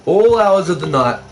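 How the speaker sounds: normal talking voice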